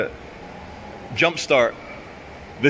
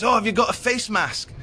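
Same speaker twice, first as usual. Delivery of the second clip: shop lady; 9 semitones higher than usual. Retold or imitated. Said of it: "and the lady goes".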